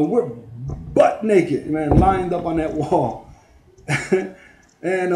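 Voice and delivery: normal talking voice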